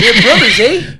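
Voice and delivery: lightly put-on Canadian accent. Co-host teasing about their Canadian sister station, mock Canadian accent. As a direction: joke in a mock Canadian lilt. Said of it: "That's a good Canadian accent there".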